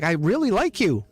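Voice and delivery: high, whiny I-really-like-you; mock heartbroken plea; pleading mock lament. Dumped partner pleading with the one who left. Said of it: "And you're like".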